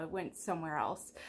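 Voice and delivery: usual speaking voice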